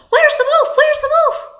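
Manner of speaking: high, loud urgent questions; frantic high-pitched search cry; alarmed villagers shouting. Acted. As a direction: call out urgently as you come running.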